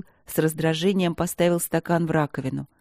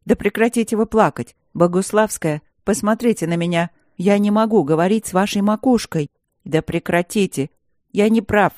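louder, frustrated shouting; exasperated yelling; 2 semitones higher, 4 dB louder than usual. Acted. Max shouts at the crying student, loud and exasperated. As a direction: demand she stop crying. Professor yells at crying girl.

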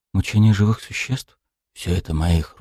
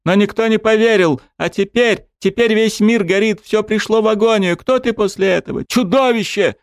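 crying scream; 13 semitones higher than usual. A narrator's reading. Weeping dryad screaming at the god that the world burns. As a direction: sob and scream it out. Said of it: "По её глазам потекли слёзы".

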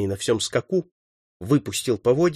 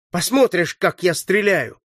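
raised pitch, eager and proud; delighted boast; 6 semitones higher than usual. A narrator's reading. Boy about to prove his aim, delighted boast. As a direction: promise a show of shooting. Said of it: "обрадовался сын казнённого".

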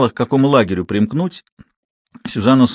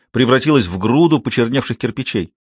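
usual speaking voice

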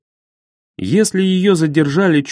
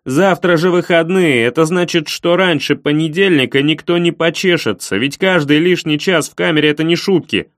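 angry shouting; 3 semitones higher than usual. Acted. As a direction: shout it at her. Caller losing patience, yelling.

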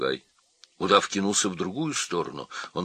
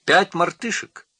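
high, loud monkey voice; squeaky, eager monkey; monkey chiming in; 12 semitones higher, 8 dB louder than usual. A narrator's reading.

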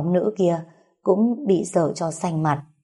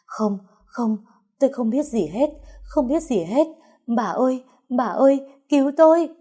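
panicked scream; 7 semitones higher than usual. A narrator's reading. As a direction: shriek it out in terror. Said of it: "hét lên thất thanh".

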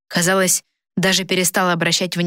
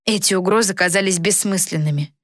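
usual speaking voice

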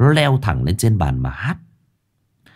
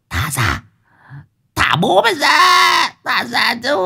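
very high possessed screech; shrieking demand; slower than usual. Acted. Possessed Hoàng Anh struggling to be let go, high shriek. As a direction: scream it out, stretched and piercing.

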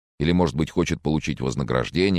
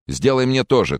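high, raised call; 10 semitones higher, 4 dB louder than usual. A narrator's reading. Charles asks Francis in the kitchen for a drink, calling out. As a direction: call over to someone in another room. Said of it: "крикнул Чарльз".

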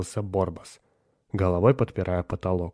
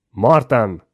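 loud fatherly call; 9 dB louder than usual. A narrator's reading. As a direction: call your son from another room. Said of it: "позвал отец". (громкий отцовский зов)

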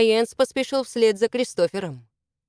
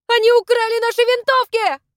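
high, loud cry of alarm; alarmed shout; 6 dB louder than usual. A narrator's reading. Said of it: "крикнула она".

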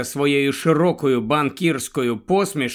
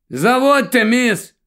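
higher, louder call to start the car; shouting; 9 semitones higher, 5 dB louder than usual. A narrator's reading. Helen shouts to the woman to start the car, loud. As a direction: call out over the engine. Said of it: "кричить Гелен".

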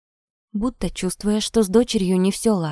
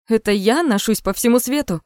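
indignant snort, pitched up; scoffing; 4 semitones higher, 4 dB louder than usual. A narrator's reading. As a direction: scoff at the accusation. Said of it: "фыркнула Полина Анатольевна".

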